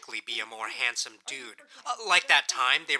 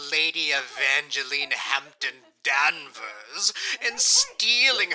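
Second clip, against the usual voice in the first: mocking melodrama; 3 semitones higher than usual. Mocks the show's melodramatic plot.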